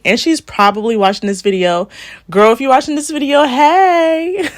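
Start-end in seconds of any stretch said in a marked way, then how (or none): 2.3-4.5 high, loud, quick squeal of a greeting; excited shout-out. Real, not acted. Vlogger shouting out to her roommate watching the video, excited and loud.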